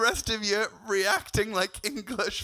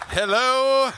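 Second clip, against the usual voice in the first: big hello; 4 semitones higher, 9 dB louder than usual. Real, not acted. Comic greeting crowd on arrival.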